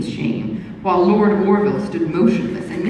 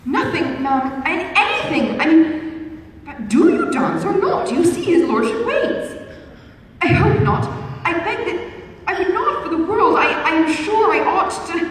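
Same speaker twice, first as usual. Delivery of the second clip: flustered stammer; 10 semitones higher than usual. A narrator's reading. Young lady flustered before Lord Orville, higher and stammering. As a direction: trip over the words in panic.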